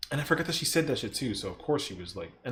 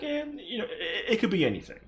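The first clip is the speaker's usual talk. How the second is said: vague shrug; 7 semitones higher than usual. Retold or imitated. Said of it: "you're kinda like".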